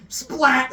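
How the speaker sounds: loud high splat noise; splat sound effect. Acted. Storyteller voicing thrown goo, loud splat. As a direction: smack out the splat.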